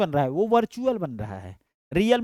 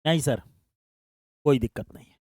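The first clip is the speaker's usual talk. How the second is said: voicing a student; 5 semitones lower, 4 dB quieter, faster than usual. Teacher voices student's answer.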